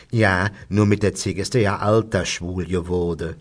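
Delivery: put-on matter-of-fact Kölsch old-timer; old undertaker. Undertaker continues his anecdote, old local's dialect voice. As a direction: carry on gossiping in broad dialect. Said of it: "Und Franke fährt fort".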